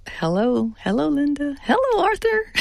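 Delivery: high hellos, climbing with each greeting; imitating childlike greetings. Acting elderly cousins' first phone call, high childlike hellos. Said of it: "just like little kids who were meeting for the first time".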